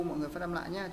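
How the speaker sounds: ordinary delivery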